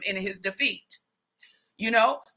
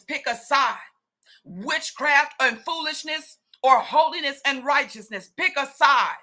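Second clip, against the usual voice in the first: fervent preaching; 3 semitones higher than usual. Real, not acted. Preacher urging the church to choose, fervent. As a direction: drive the choice home.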